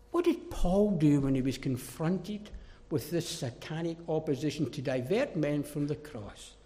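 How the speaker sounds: usual speaking voice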